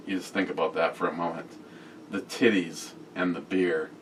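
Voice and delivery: ordinary delivery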